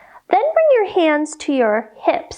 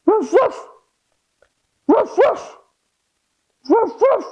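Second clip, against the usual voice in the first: high-pitched double woofs; dog barking; 8 semitones higher than usual. Shown as a demonstration. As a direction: bark like a small dog. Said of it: "let me hear your best dog sound".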